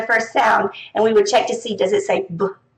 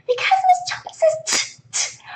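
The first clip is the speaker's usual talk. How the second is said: put-on child explaining. Shown as a demonstration. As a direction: explain like a little kid. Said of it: "kids are gonna be like".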